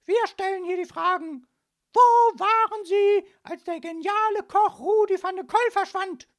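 shrill, shouted interrogation; very high, overbearing policewoman; thundering. A narrator's reading. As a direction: fire the question at full volume. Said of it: "donnerte die hochgewachsene Polizistin".

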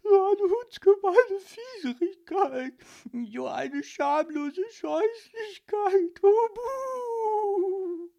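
high, loud, slow whining lament; wailing sob. Acted. Heartbroken ghost reading the rejection, sobbing. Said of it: "schluchzte jämmerlich".

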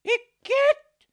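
very high, loud igitt; disgusted shriek. Acted. Mother seeing her daughter hold a mussel, shrieking. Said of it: "rief Frau Linkmann erschrocken".

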